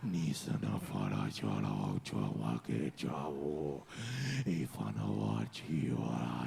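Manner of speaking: very low, slow possessed gibberish; deep, drawn-out demon incantation. Acted. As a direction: growl out a demonic tongue, gasping between phrases. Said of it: "Demon voice is gonna eat me".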